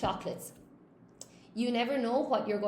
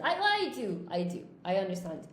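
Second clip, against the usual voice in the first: ordinary talk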